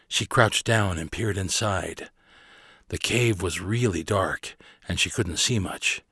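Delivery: ordinary delivery, nothing marked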